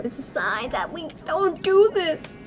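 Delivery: half-joking whiny cry; mock whimper. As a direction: whimper it like a scared kid. Fearful worry before dragon fight.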